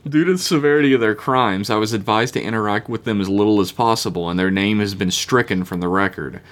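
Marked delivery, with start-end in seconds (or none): none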